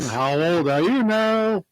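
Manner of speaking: slow, prying party guest; nosy relative. Retold or imitated. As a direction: drag it out like a nosy aunt. Mimicking a birthday guest.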